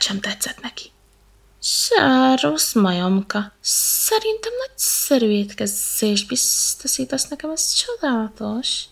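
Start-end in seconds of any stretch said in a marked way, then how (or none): 1.6-8.8 slow, hissing snake voice; very slow, sly hissing serpent. Acted. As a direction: savour each word like a meal. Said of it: "sziszegte a pápa szemes kígyó".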